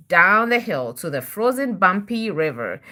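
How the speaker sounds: ordinary delivery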